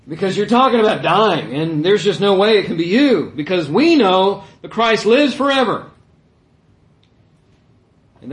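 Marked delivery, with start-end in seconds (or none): 0.1-5.9 loud, insistent acted objection; argumentative protest. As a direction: argue it forcefully as the crowd. Voices crowd arguing with Jesus.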